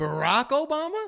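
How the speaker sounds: high, loud slow disbelief; surprised. Real, not acted. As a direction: ask it stunned, stretching the name.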